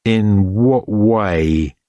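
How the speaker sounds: slow, pointed question; impatient. A narrator's reading. Dirk loses patience with the old man, slow and pointed. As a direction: press for an answer, fed up. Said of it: "running out of patience at last".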